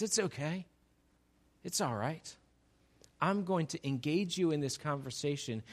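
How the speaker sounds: calm her down quietly; soft reassurance. Acted. Jesus gently reassuring the woman at the well, soft and calm. Jesus calms the awkward moment.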